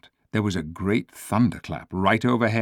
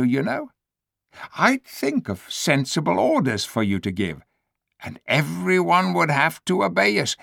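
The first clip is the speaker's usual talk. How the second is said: higher, coaxing ape voice; higher, persuasive voice of a sly ape; scheming ape; 9 semitones higher than usual. Acted. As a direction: sell the plan like a schemer.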